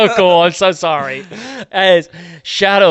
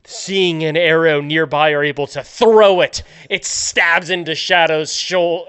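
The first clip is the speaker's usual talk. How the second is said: ordinary talk, nothing marked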